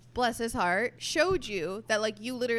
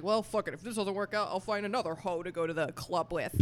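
dude voice; 2 semitones lower than usual. Retold or imitated. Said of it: "'cause you're just like".

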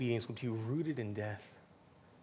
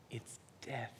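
hushed whisper; 9 dB quieter than usual. Real, not acted. Solemn point in sermon.

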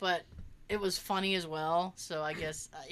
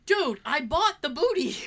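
ordinary delivery

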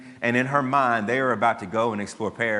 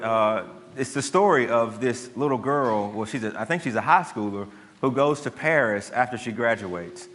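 usual speaking voice

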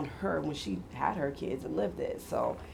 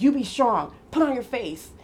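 stern mom; 7 semitones higher, 7 dB louder than usual. Retold or imitated. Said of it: "She was like".

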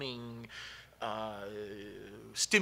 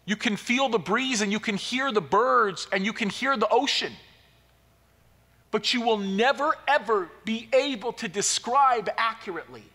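louder, higher, swelling sermon delivery; preaching at full force; 9 semitones higher, 6 dB louder than usual. Acted. Pastor urging listeners to imagine first sight, fervent preaching. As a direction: lift the room toward the climax.

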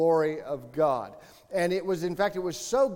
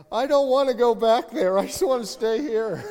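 higher, louder mock-wailing wife; imitating crying wife; 6 semitones higher, 6 dB louder than usual. Pastor imitating his wife sobbing on the last night, mock wail. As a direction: wail it like a wife who won't go home. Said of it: "And she's like".

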